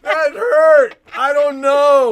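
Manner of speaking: high, loud pained yelp; jolted by the violent corner; loud mock alarm; coaster fright. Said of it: "you're like".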